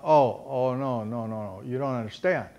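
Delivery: a hint of a low, soft fatherly voice; gentle father. Father telling his eager son he misunderstands, a touch low and soft. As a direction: softly let him down, lightly voiced.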